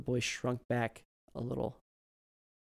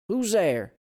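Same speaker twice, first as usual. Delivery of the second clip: put-on raised, loud old man; old man calling out; 9 semitones higher, 9 dB louder than usual. A narrator's reading. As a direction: call into the dark.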